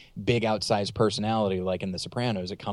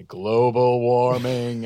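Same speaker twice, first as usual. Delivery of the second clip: very slow, drawn-out pronouncement; mock-grave announcement; 2 semitones higher, 5 dB louder, much slower than usual. Actor warning the audience mid-film, very slow and portentous. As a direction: stretch each word out for comic weight.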